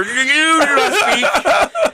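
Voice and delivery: slow, high, lordly command; pompous command. Pretend. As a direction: decree it grandly through a laugh. Pompous claimant silencing manager.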